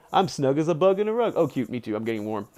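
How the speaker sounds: ordinary talk, nothing marked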